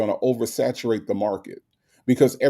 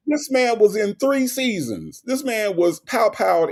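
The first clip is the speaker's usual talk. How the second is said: exasperated; 9 semitones higher than usual. Real, not acted. Heated debate, high emphatic pitch.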